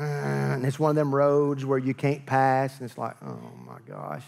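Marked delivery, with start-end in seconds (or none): 3.2-4.2 very low, slow, muttered groan; mock exasperation. Driver stuck behind a slow car, mock exasperation. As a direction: grumble it low and drawn-out.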